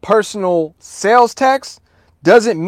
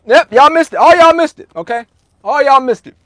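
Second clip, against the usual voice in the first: high, louder playful teasing of the chat; teasing chat callout; 7 semitones higher, 5 dB louder than usual. Real, not acted. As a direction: rub it in, sing-song and high. Teasing chat about missed answer.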